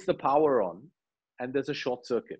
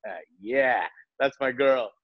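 proud; 2 semitones lower, slower than usual. Retold or imitated. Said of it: "there's such pride on his face".

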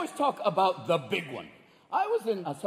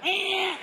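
high imitated cat yowl; short squealed animal cry; panicked cat screech; 13 semitones higher, 4 dB louder than usual. Cat braced in the doorway before a quake, screeching. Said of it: "your cat is spread-eagled in the doorway like".

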